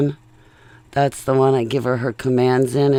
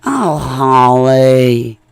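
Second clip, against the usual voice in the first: loud, hugely drawn-out name calling; exaggerated mocking call, swooping up high at the end; mock name-mangling; 5 semitones higher, 11 dB louder, much slower than usual. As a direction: stretch the name out until it's ruined. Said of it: "they ruin it".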